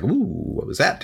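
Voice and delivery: acted high "ooh" of surprise; mildly startled. Storyteller voices Kate hearing a strange voice, mildly startled. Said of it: "she's kind of startled".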